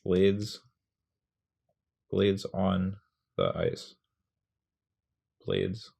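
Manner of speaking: blades on the ice, slow, first word punched; stress on blades. Shown as a demonstration. Teacher sounding out the rhythm of blades on the ice. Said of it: "we're gonna say blades".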